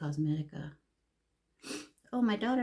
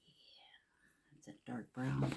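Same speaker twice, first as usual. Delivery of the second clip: whispering; 3 semitones lower, 10 dB quieter than usual. Real, not acted. Whispered while lining lips.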